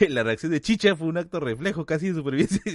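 amused, laugh-filled outburst. Real, not acted. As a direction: laugh while confessing it. Laughing at his own reflex.

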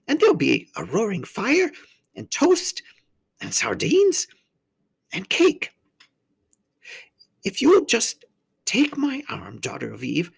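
high-pitched faun voice, listing treats; coaxing faun. A narrator's reading. Faun luring the girl to tea, high and coaxing.

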